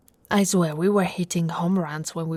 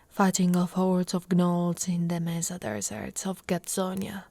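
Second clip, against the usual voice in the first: normal talking voice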